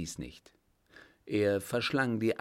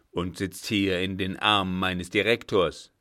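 louder, mocking old man; scornful accusation; 2 semitones lower, 7 dB louder than usual. A narrator's reading. (höhnisch anklagend, laut)

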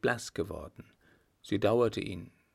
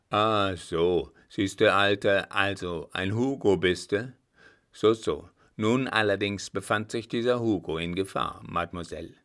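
put-on jovial old soldier, louder and firm; blunt old marshal; 5 dB louder than usual. Marshal reacting to the name Hugo, loud and amused. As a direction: play the gruffly amused old warhorse.

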